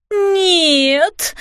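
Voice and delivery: high, drawn-out cry of "no". A narrator's reading. Fairy mother showered with flour, crying out. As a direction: wail it out high in dismay. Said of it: "закричала мамочка-фея".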